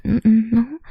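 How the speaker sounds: ordinary talk, nothing marked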